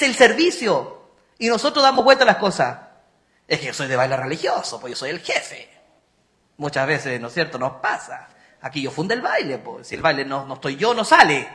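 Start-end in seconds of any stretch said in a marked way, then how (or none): 3.5-11.5 low, smug put-on voice; mocking proud dancer. Priest mocking a boastful religious-dance chief, low smug voice. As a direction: send up the boastful leader, voice dropped low.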